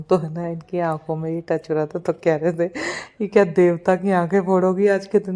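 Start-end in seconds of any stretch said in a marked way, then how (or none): 3.2-5.3 teasing husband. Retold or imitated. Husband teasing about the sieve.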